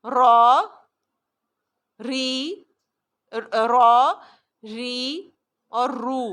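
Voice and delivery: را ری رو recitation; ra ri ru drill. Shown as a demonstration. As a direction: punch را, soften ری. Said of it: "جب یہ را متحرک ہے".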